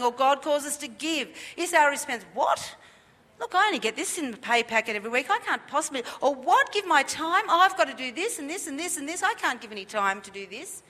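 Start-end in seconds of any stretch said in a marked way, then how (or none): 2.3-6.0 hurried, incredulous mock protest; ungrateful excuse. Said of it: "That's lacking gratitude". 6.3-10.8 high, indignant mock complaint; reluctant protest. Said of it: "That's lacking gratitude".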